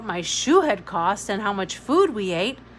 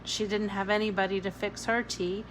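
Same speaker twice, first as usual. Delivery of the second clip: usual speaking voice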